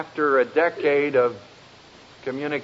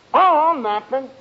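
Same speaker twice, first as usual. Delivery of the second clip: mock sulky denial; 8 semitones higher, 3 dB louder than usual. Sulking husband denies trouble.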